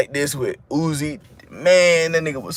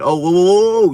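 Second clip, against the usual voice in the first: louder, hammed-up whoa whoa; mock startled rapper; 4 semitones higher, 6 dB louder than usual. Rapper hearing fans want old songs, mock startled. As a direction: play the artist caught off guard.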